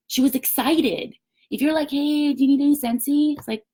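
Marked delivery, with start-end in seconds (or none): 1.9-3.4 high, level sales question; unexcited pitch. Shown as a demonstration. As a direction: ask it on one unvarying note, without excitement. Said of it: "You need to be excited".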